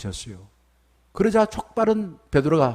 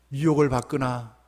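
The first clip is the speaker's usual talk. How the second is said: ordinary delivery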